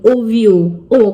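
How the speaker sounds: ordinary delivery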